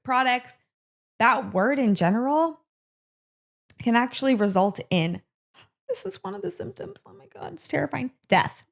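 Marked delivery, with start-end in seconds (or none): 5.9-8.6 hushed aside. Real, not acted. Aside whispered in horror.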